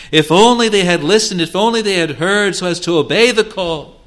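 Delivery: rising, fuller pulpit voice pleading over lost chances; impassioned sermon appeal; fervent preaching. Acted. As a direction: preach it out with longing.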